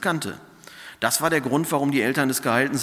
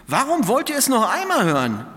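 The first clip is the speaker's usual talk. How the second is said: indignant retort; 10 semitones higher, 3 dB louder than usual. Acted. Healed man challenges the Pharisees.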